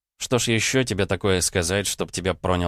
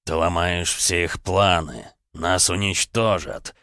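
put-on slow creaking old voice; creaky voice; slower than usual. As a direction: creak like an unoiled cart. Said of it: "голос алтаря скрипел хуже несмазанной телеги".

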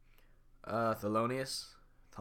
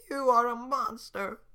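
high, slow, squeaky little cookie; gingerbread man voice; 9 dB louder, slower than usual. Acted. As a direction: squeak it out, small and hurt. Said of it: "the gingerbread man's voice for now".

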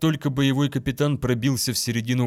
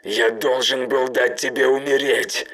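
coughed out; 3 semitones higher, 6 dB louder than usual. Acted. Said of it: "прокашлял он".

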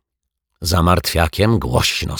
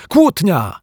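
high, falling exclamation of KŁÓTNIA; jubilant, high-pitched announcement; overjoyed cry; 13 semitones higher, 4 dB louder than usual. A narrator's reading. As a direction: cry "Kłótnia!" as glorious news. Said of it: "wrzasnął uszczęśliwiony detektyw Pozytywka".

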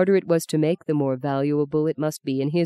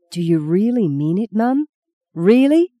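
higher, louder eager rising question; thrilled disbelief; 5 semitones higher, 3 dB louder than usual. Acted. Son overjoyed his mother will send him to Rome. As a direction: burst out, hardly believing it.

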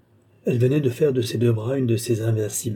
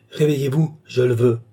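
commanding cry; 4 dB louder than usual. A narrator's reading. Balsamo orders Lorenza out of her trance, commanding cry. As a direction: order the sleeper awake, voice lifted. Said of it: "s'écria-t-il".

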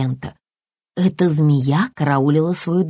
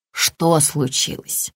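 ordinary delivery, nothing marked

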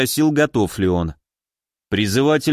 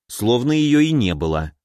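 usual speaking voice

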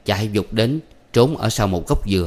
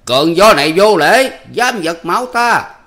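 loud, higher outraged judge's voice; loud stage fury, rising high; angry; 10 semitones higher, 7 dB louder, slower than usual. Acted. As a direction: bristle at the insulting wind. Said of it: "Nổi giận".

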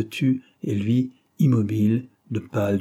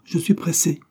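higher, curt brush-off with mild disgust; disgusted dismissal; 8 semitones higher than usual. A narrator's reading. Girl refusing to stop for the suitor, disgusted dismissal. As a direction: brush him off in a raised pitch. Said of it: "dit-elle en détournant la tête avec dégoût".

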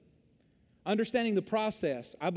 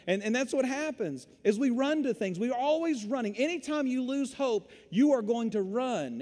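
ordinary talk, nothing marked

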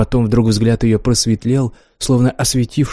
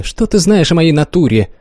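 a sharp, cutting interruption, mildly raised; sharp retort; 6 semitones higher, 3 dB louder than usual. A narrator's reading. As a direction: cut him off sharply, a touch higher. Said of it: "Резко перебила она меня". (резко перебивая)

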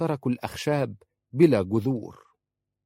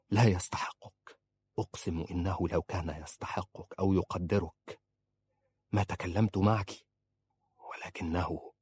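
quiet confession; 2 semitones lower, 5 dB quieter than usual. Acted. Saleh confesses, cut off.

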